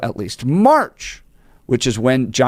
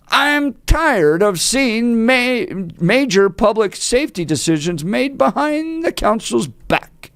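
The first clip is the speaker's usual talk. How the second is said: higher, slow exasperated quote; a hint of the exasperated councilwoman; quoted councilwoman; 8 semitones higher than usual. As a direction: read her frustration. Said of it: "said Councilwoman".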